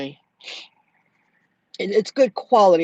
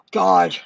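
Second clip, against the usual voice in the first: weary sigh; 13 semitones lower, 5 dB louder than usual. Real, not acted. Disgusted by rude customer.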